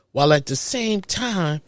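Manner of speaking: ordinary talk, nothing marked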